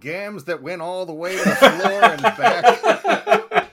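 slow, loud hammed-up noir narration; noir detective. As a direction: drawl it like a pulp detective.

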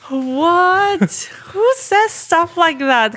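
high, gasping what; shocked disbelief. Real, not acted. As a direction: gasp and protest, scandalised. Gasp at the slang.

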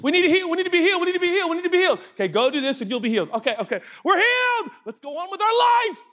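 high, mock pleading then breezy; hammed-up plea then brush-off; needy believers. Preacher voicing believers who only want healing, high mock plea. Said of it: "The cycle is".

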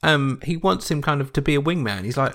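usual speaking voice

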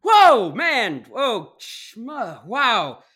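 high, loud startled whoas; dazed waking up. Acted. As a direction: come to with a jolt, dazed. Grant waking from blackout, dazed.

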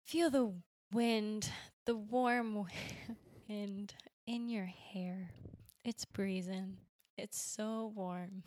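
soft, slow, whispery meditation read; guided meditation voice. Pretend. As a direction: lull them onto a calm beach in a hushed voice. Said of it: "you could lead me through a very relaxing meditation visualization".

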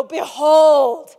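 loud, slow showman unveiling; grand product reveal. Retold or imitated. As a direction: announce it like a keynote showman. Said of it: "he'd go".

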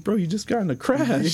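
high-pitched retold disbelief; incredulous protest. Warning his brother after the crash, incredulous.

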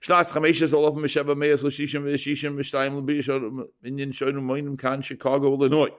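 monotone, chant-like recitation; formal reading without inflection; ceremonial document reading. Acted. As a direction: intone the date on one held pitch. Reads out the marriage contract.

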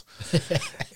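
a quick high chuckle; short laugh. Real, not acted. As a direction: burst out laughing briefly. Laughs at terrified teammate story.

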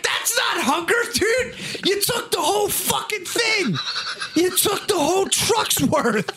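high-pitched, hammed-up outraged dad; mock exasperated scolding. Dad berates son for taking the whole truckload, mock outrage. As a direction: scold like a dad who cannot believe it.

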